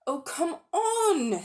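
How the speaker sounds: high, slow impatient plea; impatient boy. Acted. Diggory pleading with Polly, slow and high. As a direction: urge her along, drawn out.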